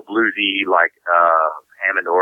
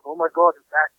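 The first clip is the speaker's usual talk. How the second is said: retold alarm; 8 semitones higher than usual. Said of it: "He goes".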